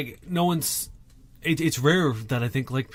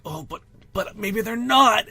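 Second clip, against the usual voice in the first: doubtful whine; 6 semitones higher, 3 dB louder, slower than usual. Retold or imitated. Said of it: "you're just like".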